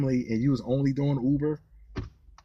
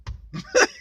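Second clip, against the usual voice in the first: high burst of laughter. Real, not acted. Laughing at own bit.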